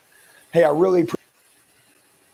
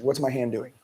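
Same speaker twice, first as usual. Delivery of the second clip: normal talking voice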